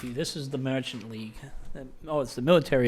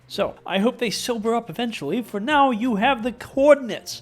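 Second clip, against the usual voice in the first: character voice; 5 semitones higher, 6 dB louder than usual. Acted. Voiced game character speaking.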